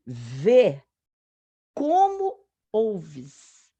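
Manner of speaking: VÊ and OUVES spaced apart, very slow; scandito. Shown as a demonstration. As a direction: set out VÊ, pause, then stretch COMO OUVES. Isolating the two senses in verse.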